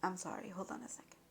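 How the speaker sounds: a quick hushed apology, barely voiced; whispered aside. Real, not acted. Reader pausing mid-reading, whispered apology to the viewer. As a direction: breathe it out under your breath.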